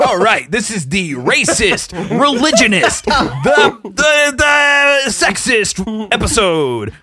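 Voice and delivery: building to a slow shout; mock announcer. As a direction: hype it up like an announcer. Mock announcer intro, rising.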